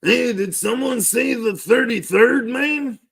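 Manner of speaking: high-pitched put-on voice butting in; Andrew Guzman. Playing Andrew Guzman interrupting about the thirty-third, high mock voice. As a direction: pitch it way up, sing-song mock impression. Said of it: "Shut up, Andrew Guzman".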